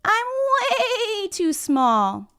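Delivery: high, squeaky-small goat voice with stretched vowels; little goat. Acted. Littlest goat answers the troll on the bridge, high little-goat voice. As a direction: plead slowly as a tiny storybook goat. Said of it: "little billy goat says".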